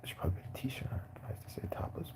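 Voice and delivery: hushed whisper to himself; a muttered whisper while reading. Real, not acted. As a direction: whisper it under your breath. Reading a stream quietly.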